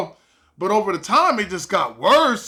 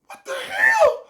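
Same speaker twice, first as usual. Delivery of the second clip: much higher, laughing shout; shrieking disbelief; slower than usual. Retold or imitated. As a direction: shriek it half-laughing at the chaos. She walks off after key.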